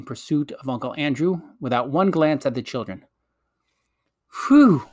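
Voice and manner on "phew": high, loud sigh of relief; relieved. A narrator's reading. Polly once the witch has left the room, relieved. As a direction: let out a long "phew" that falls from a high start. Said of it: "letting out a long breath of relief".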